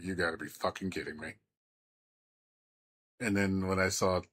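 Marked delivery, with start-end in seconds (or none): 0.0-1.3 low, quiet and fast; quick low mutter of disbelief. Retold or imitated. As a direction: mutter it under your breath, stunned. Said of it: "I was like".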